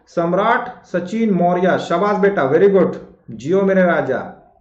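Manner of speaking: ordinary talk, nothing marked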